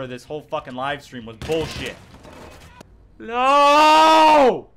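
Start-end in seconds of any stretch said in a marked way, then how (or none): 3.2-4.7 high yelled no; frustrated shout. Real, not acted. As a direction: cry out at the screen. Dies again.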